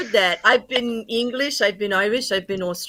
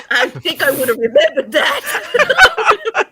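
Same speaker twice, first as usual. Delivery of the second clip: high, drawn-out words through laughter; laughing; 10 semitones higher, slower than usual. Real, not acted. Panellist reacting to the banana suit joke, laughing. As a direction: squeal it slowly, helpless with laughter.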